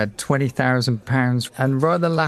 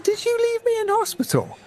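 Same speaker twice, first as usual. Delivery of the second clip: put-on childlike voice. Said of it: "I'm like".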